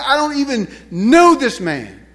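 high, loud, indignant disavowal; emphatic punch on "know"; resolute denial. Acted. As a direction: disown him with conviction. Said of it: "There was no questioning".

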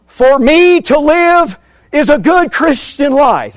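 high, loud, slow mock quote of Paul; hammed-up wrong version, pitched high. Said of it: "Is that what he said?".

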